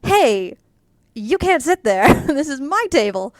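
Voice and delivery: mock scolding from a stranger at the table; imagined annoyed stranger. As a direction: play the territorial woman, laughing through it. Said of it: "I can hazard a guess that it was".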